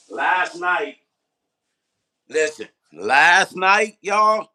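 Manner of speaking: slow, high, loud excited build to the crowd; hyped up. Real, not acted. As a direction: stretch it out like you can't contain the hype. Hyping up last night's live.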